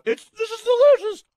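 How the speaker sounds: high, loud burst of delight; delighted exclamation. Real, not acted. Host tasting the sandwich on air, thrilled high exclamation. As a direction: squeal that it's delicious. Raving while eating sandwich.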